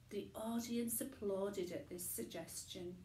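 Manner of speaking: ordinary delivery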